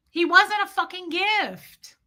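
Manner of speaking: high, heated outburst about an ex; angry outburst. Real, not acted. Woman venting to her viewers about an ex, angry outburst. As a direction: let the frustration push your voice way up.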